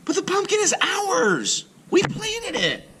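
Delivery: put-on very high, pleading girl voice; protesting girl. Joan protests to Mister Klug, high and pleading. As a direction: protest like an upset child.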